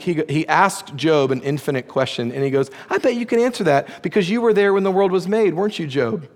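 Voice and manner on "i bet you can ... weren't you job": high, fast mocking dare; sarcastic taunt. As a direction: taunt him like a smug know-it-all. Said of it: "he actually gets so sarcastic".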